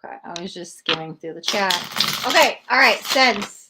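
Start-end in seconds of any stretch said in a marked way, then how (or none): 0.0-1.7 soft unvoiced whisper to herself; whispered aside. Real, not acted. Reader muttering to herself over the chat, whispered. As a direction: breathe it out while skimming.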